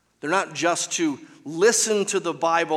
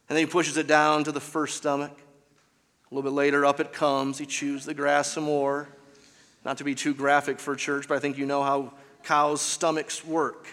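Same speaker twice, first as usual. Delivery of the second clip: ordinary talk, nothing marked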